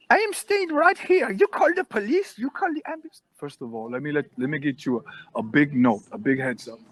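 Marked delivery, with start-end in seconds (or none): 0.1-3.2 put-on very high, squealing old-lady voice; defiant old woman. Woman daring guard to call police, high defiant squeal.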